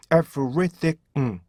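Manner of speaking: everything slowly broken up, ending 응; staccato everything with a final hummed 응; syllable by syllable. Shown as a demonstration. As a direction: separate each beat of everything. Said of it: "응이에요".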